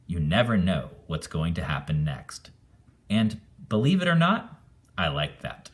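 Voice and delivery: ordinary delivery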